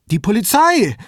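high, loud, frightened outburst; panicked. A narrator's reading. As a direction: blurt it out in distress. Distraught caller in shock. (panisch, mit hoher Stimme)

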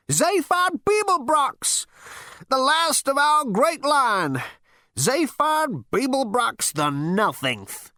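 put-on high, slow, scornful elderly screech; screeching old man. A narrator's reading. Ancestor scorns his great-grandson, slow high screech. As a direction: screech each word out slowly. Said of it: "seemed to him to screech like fingernails".

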